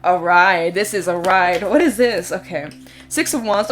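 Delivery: ordinary delivery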